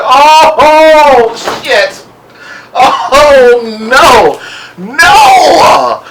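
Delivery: high, loud shocked cry. Retold or imitated. Vlogger reliving watching the wig pulled off, shocked cry. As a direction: gasp it out in disbelief.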